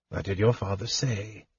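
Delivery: very soft, tender question; gently. A narrator's reading. Old wizard coaxing the kender to go on, gently. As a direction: ask it softly, barely above a breath. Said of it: "asked Fizban gently".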